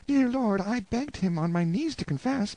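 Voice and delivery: put-on high, trembling plea of a desperate young wife; pleading wife. Anguished wife begging the lord to persuade Hugo, high pleading voice. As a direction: beg him to save your husband.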